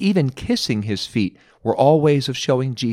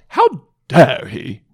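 mock-indignant British "How dare he?"; slow, loud posh British huff; a lightly voiced British outrage; British accent; 6 dB louder, much slower than usual. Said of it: "I almost hear it in a British accent".

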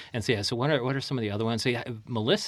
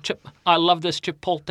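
comedian impression; 7 semitones higher, 6 dB louder than usual.

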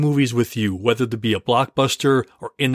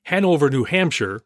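normal talking voice

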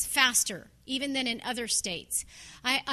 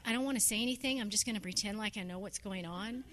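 much softer, confiding under-breath aside; aside to a friend; 5 semitones lower, 8 dB quieter, faster than usual. Retold or imitated. To Becky about the Australian guy, a quiet aside. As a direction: lean over and admit it quietly. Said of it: "and I was like".

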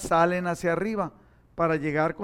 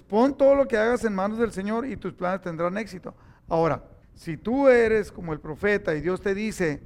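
ordinary talk, nothing marked

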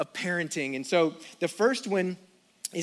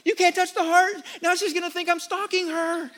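much higher, frantic pleading voice; panicked teenage son; 10 semitones higher, 3 dB louder than usual. Retold or imitated. Son panicking as dad likes a girl's photo, high frantic. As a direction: beg him to stop, panicking. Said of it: "and the son goes".

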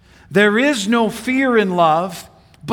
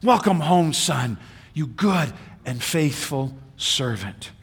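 tender father; 6 semitones lower, 6 dB quieter than usual. Retold or imitated. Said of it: "I want Dad to go".

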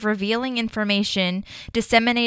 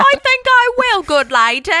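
much higher, louder, cartoonish mock Cockney; over-the-top Cockney; 7 dB louder than usual. Topping her co-host's try, an absurd over-the-top Cockney. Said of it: "What the hell is that?".

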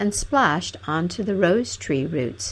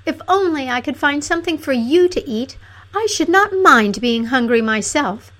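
higher, louder princess voice; self-sacrificing princess; 7 semitones higher, 5 dB louder than usual. A narrator's reading. Princess wishing she could feed Fanfaronade, lamenting. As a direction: plead devotion in a high voice.

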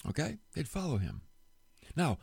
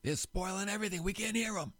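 higher-pitched mock complaint; imitated grumble, pitched up; annoyed onlooker; 5 semitones higher, 4 dB quieter than usual. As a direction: whine lightly like a put-out listener. Acts out annoyed onlookers' thoughts.

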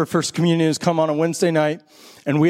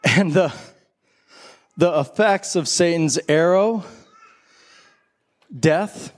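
communion liturgy; 4 semitones lower than usual. Acted. Pastor leading the congregation through communion, solemn and low. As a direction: speak the words of institution with hushed reverence.

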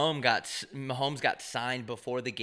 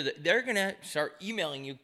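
usual speaking voice

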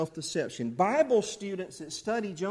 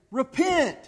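short, louder street-preacher cry; calling out; 5 semitones higher, 5 dB louder than usual. Pretend. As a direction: call it out like a warning to the town. Acting out street preaching through town.

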